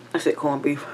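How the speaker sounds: unvoiced whisper repeat. Real, not acted. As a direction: breathe it out, no voice. Repeats word for partner.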